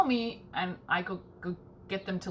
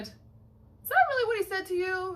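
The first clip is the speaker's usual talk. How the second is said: incredulous quote; 10 semitones higher than usual. Retold or imitated. Retold quip to security guard.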